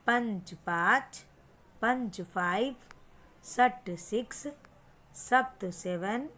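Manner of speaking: slow, sing-song count with a rise on each Sanskrit number; teacher's counting chant; counting drill. Shown as a demonstration. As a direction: chant the numbers so the child can copy.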